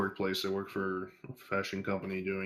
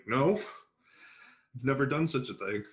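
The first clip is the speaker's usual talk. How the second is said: higher-pitched mock innocence; retold denial; 5 semitones higher than usual. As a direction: deny it in a raised, acted voice. Retold denial to his boss.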